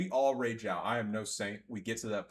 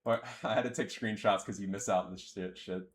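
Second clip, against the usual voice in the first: ordinary delivery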